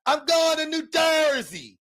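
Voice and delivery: put-on high, slow shouted Boston accent; mock Boston accent. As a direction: yell it with stretched Boston A's. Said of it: "who puts that many A's in".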